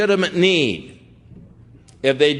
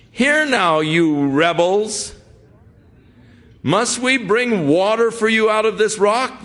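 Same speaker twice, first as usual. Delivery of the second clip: scolding rebels; 7 semitones higher than usual. Acted. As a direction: scold the crowd like a furious prophet. Moses scolds the rebellious people.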